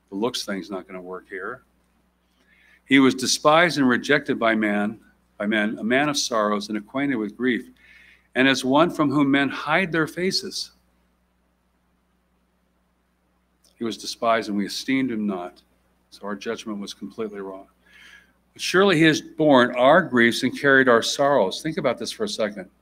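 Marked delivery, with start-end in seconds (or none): 2.9-15.6 measured formal Bible reading; scripture reading. Acted. Reads Isaiah aloud. 18.5-21.6 measured formal Bible reading; scripture reading. Acted. Reads Isaiah aloud.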